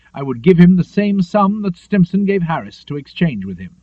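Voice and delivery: usual speaking voice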